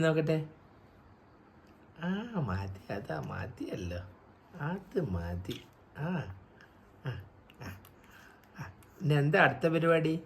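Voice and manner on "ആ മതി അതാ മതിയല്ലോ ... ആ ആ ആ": soft soothing. Real, not acted. Soothing baby, voice dropping soft.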